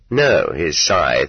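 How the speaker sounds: plain reading voice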